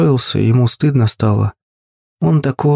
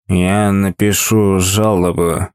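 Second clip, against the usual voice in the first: put-on drunk indignant; 5 semitones lower, slower than usual. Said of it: "возмутился шеф баланса".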